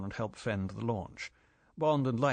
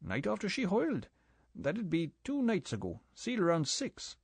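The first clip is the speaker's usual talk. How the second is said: higher-pitched island boatman, easy and gossipy; 9 semitones higher than usual. A narrator's reading. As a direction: play the chatty local boatman, voice pitched up. Boatman character voiced.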